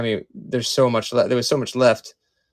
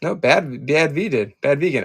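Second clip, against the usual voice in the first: usual speaking voice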